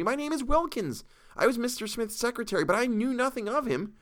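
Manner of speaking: high anxious pleading; anxious explanation. A narrator's reading. Captive explains he was only the secretary, high anxious. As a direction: explain yourself, hoping they listen. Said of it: "with some hope left in me".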